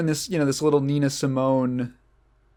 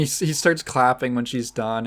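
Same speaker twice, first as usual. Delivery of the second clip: ordinary talk, nothing marked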